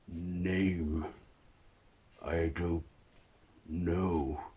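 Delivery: drawn-out words with long gaps; halting, uncertain monster; creature's halting speech. Acted. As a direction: struggle slowly for each word as the creature.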